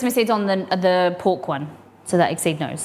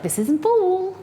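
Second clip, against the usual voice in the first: mock complaint; 11 semitones higher, slower than usual. Mock complaint at gas shop.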